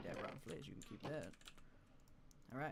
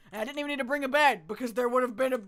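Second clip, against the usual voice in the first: loud excited rush; 7 semitones higher, 13 dB louder, faster than usual. Real, not acted. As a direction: blurt it out, excited and quick. Excited outburst about beds.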